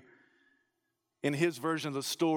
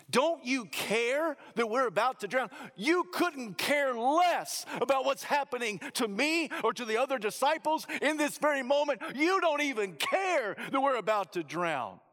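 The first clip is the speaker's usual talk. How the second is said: raised, pleading accusation; accusing; 7 semitones higher than usual. Acted. Disciples accusing the sleeping Jesus, indignant. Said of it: "Do you hear the indictment in their voice?".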